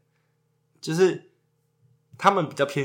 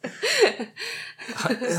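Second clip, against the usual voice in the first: high giggling laugh; laughter; 13 semitones higher than usual. Real, not acted. Couple joking about mock commands, high laughing.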